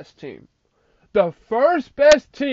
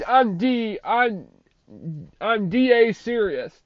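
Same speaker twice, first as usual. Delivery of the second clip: ordinary talk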